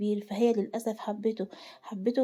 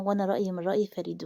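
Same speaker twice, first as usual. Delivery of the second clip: ordinary delivery, nothing marked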